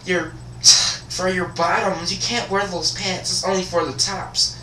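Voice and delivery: high, halting put-on voice; flustered administrator. Administrator explaining the dress code, high flustered imitation. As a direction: stammer it in a high, huffing mock voice.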